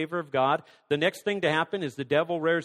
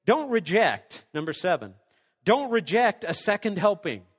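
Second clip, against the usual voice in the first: ordinary talk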